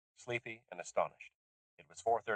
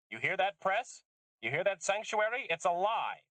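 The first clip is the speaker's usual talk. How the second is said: higher, louder defiant taunt; defiant call-out; 11 semitones higher, 3 dB louder than usual. Acted. Susan yelling at the press and Sanctuary, defiant. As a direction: throw it at the listening press. Shouting at eavesdroppers.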